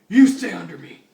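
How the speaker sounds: loud sudden command; barked order. Acted. Batman ordering RoboCop to stay under him, loud bark.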